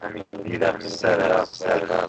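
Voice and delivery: glitchy robotic voice from a bad connection; choppy, robotic call audio. Real, not acted. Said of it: "[name], we got the robot voice".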